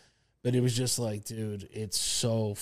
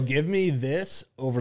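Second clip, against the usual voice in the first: usual speaking voice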